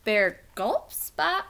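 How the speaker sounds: ordinary delivery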